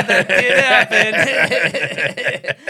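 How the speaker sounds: slow laugh-filled words. Real, not acted. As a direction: laugh through the line. Laughing at shared memory.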